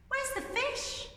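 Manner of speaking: put-on high, slow child voice; high puzzled kids' voice; children's voice. A narrator's reading. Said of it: "said the children".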